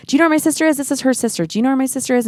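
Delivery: high, pleading repeated question; pressing strangers for any news; desperate asking. Retold or imitated. Sister calling friends, pleading and high.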